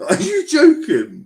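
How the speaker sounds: very high, loud, drawn-out question; utter disbelief. Real, not acted. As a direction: squeal it in slow disbelief. Disbelief at co-host's low ranking.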